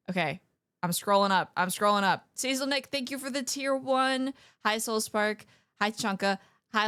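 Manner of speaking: usual speaking voice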